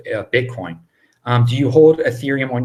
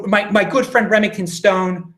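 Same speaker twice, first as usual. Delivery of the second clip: ordinary delivery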